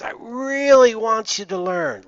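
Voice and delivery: slow down and lean hard on "really"; emphasis on REALLY. Shown as a demonstration. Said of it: "put weight or emphasis on important words".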